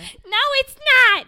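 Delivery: high, loud furious yell; shouted denial. Acted. Playing Teresa, screaming back at Danielle.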